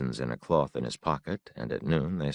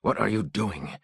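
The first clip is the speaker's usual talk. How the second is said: sharp whispered-edge question, raised pitch; hissed; 8 semitones higher than usual. A narrator's reading. Father hissing at his son for wandering off, sharp. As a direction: hiss it at the boy in alarm. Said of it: "he hissed".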